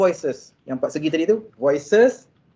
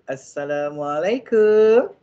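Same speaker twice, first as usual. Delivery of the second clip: tender greeting; much slower than usual. Shown as a demonstration. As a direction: greet gently so you get a hug. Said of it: "Memang kena peluk".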